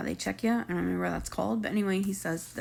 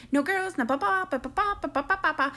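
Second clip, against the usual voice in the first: much higher mocking babble; mock Brooklyn rules; 8 semitones higher than usual. Vlogger mocking Brooklyn setting rules for Brian's friend, high babble.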